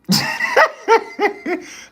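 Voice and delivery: sudden loud high laugh; amused cackle; high burst of laughter. Real, not acted. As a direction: break into a real laugh. Laughs at chat's reply.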